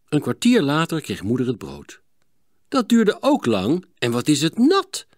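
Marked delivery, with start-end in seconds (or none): 2.7-5.1 suspicious. A narrator's reading. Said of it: "zei ze argwanend".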